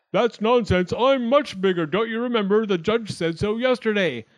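high, loud squabbling voice; indignant acorn. A narrator's reading. Rival acorn disputes who is bigger, high and indignant. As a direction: butt in loudly at a high pitch. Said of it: "the acorns set up a commotion again".

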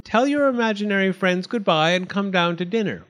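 mother summoning her daughter to dinner, called out; calling from another room. A narrator's reading.

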